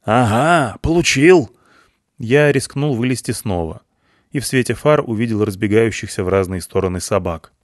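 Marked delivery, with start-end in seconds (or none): 0.0-1.5 gloating cry. A narrator's reading. Soldier gloating after shot.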